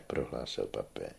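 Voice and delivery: ordinary delivery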